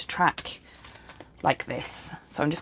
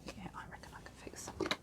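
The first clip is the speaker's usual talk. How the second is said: breathy whispered murmur; whispering; 20 dB quieter, faster than usual. Real, not acted. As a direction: whisper it under your breath. Unvoiced whisper to self.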